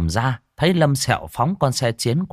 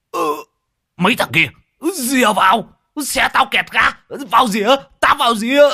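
high, loud frantic warning; panicked yell; 13 semitones higher, 4 dB louder than usual. A narrator's reading. Rider with a stuck throttle, yelling in panic. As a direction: scream at them to clear off. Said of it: "thì gào lên".

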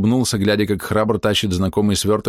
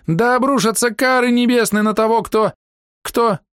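high, declaimed curse; high-voiced cursing woman. A narrator's reading. Hidden woman shouting a curse.

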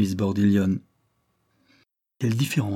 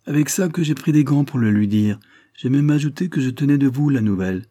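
higher, louder gloating reply; triumphant; 5 semitones higher, 5 dB louder than usual. A narrator's reading. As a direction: crow over it proudly. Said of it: "répondit Tiefel d'un air triomphant".

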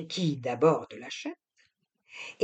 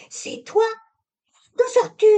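astonished; 6 dB louder than usual. A narrator's reading. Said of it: "elle parut stupéfaite".

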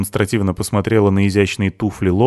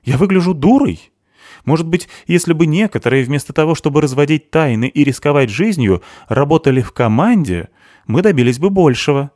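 higher, emphatic indignant rant; annoyed; 8 semitones higher, 4 dB louder than usual. A narrator's reading. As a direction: argue it crossly, pitch raised. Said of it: "рассердилась Криспи". (сердито, с возмущением)

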